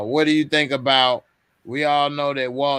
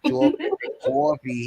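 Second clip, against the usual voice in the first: higher, hammed-up New York twang on Chocolate and coffee; mock New York accent; 12 semitones higher than usual. Copying his friend's New York way of saying it. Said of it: "I'm butchering the New York slang".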